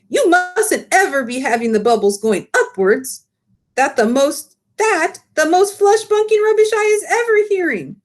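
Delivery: high, eager giant voice with muddled grammar; the BFG lecturing, indignant; big friendly giant. Acted. Giant explains bubbles.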